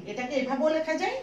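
ordinary delivery

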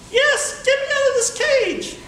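high, cartoonish little monkey voice; talking monkey. Caged monkey begging the boy to free him, high voice. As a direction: squeak it out like a tiny caged monkey.

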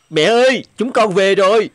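calling out. Acted. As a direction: call out cheerfully across the yard. Calling mother from outside. (gọi to báo mẹ đã về)

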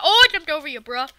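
ordinary talk

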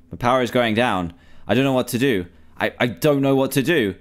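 ordinary delivery

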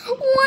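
high, loud mock baby cry; baby wail. Baby cries after being told off.

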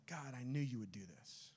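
low, soft mock grumble; muttered complaint. Jonah complaining to God, sullen low grumble. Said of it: "he complains to God".

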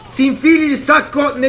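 high, loud insulting yell; real angry yell; furious shout. Real, not acted. Yells at the sack man.

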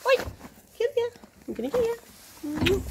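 high teasing coo. Real, not acted. Owner playfully catching her horse for a cuddle, high teasing coo. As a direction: playfully chase him with your voice.

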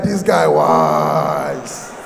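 loud, low, long-drawn cheer; a crowd of men hailing their hero; roaring male approval. Acted. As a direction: stretch the last word into a deep roar.

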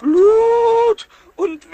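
slow, high, loud drawn-out lament; stretched-out whimpering cry over a scraped thumb; dramatic wail. Acted. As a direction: wail it like the end of the world. Pumuckl wails over bleeding thumb.

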